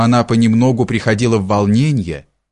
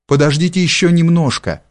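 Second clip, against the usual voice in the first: higher, gently pleading voice; 6 semitones higher than usual. A narrator's reading. Peasant girl asks departing lover to wait, pleading. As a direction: beg him with understated urgency. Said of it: "умоляющим голосом произнесла Акулина".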